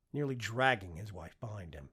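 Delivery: plain reading voice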